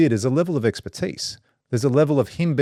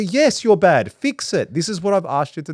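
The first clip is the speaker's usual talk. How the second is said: scolding the bot; 8 semitones higher, 3 dB louder than usual. Retold or imitated. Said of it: "I go".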